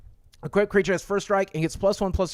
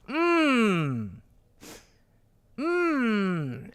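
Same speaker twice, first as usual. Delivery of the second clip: savoring hum; 3 semitones higher, 4 dB quieter than usual. Real, not acted. Savoring favorite food.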